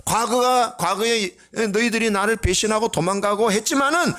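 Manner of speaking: high, ringing preacher's proclamation in Jesus's voice; preaching as Jesus. Acted. Pastor voicing Jesus to the congregation, fervent high proclamation. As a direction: proclaim it like the risen Lord rallying his men.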